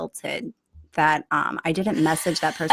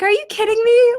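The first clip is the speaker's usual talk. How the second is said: incredulous, pitched high and louder; retold disbelief; 14 semitones higher, 6 dB louder than usual. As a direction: act out exasperated disbelief, voice jumping up. Retold disbelief at his assumption.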